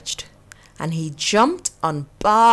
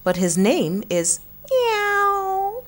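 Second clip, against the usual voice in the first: a puzzled little goat, drawn out high; goat's voice; 12 semitones higher, slower than usual. Acted. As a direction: goat telling friends the kitten's name, high and slow. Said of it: "said Meh".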